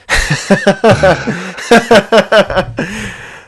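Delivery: high, loud burst of laughter; real high-pitched laughing fit; hearty laugh. Real, not acted. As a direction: laugh long and helplessly at the request. Amused by the accent request.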